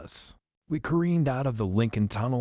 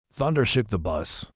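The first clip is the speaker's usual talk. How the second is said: ordinary delivery, nothing marked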